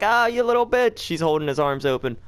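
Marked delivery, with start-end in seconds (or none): 0.0-1.0 high, sneering mock of a taunting Jesus. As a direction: play Jesus taunting the scared player. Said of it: "He's like".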